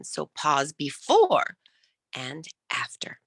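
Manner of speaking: slow, with a pause, trailing into a whisper; pause demo. Shown as a demonstration. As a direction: hold the pause, then whisper the end. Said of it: "power of the pause".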